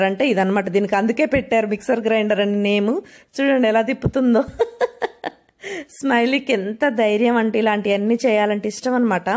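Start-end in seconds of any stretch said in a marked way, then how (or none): 4.5-5.3 a high, soft burst of laughter; amused laugh. Real, not acted. Watching her daughter spun around on the ride, a high soft laugh.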